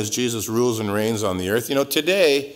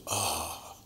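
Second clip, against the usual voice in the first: sigh of relief; 8 semitones lower, 13 dB quieter than usual. Pretend. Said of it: "the whole world is just".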